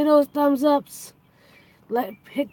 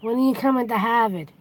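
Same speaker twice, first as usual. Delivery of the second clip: r-less Boston accent, park your car said as pak yah cah. Shown as a demonstration. Playing up the Boston accent for chat. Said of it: "I'll say it with the Boston accent".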